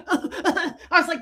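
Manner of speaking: laughing through the words; giggling as she tells it. Real, not acted. Laughing while retelling.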